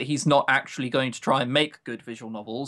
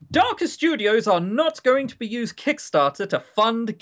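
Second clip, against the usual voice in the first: mock announcement; 7 semitones higher than usual. Mocking developer's statement.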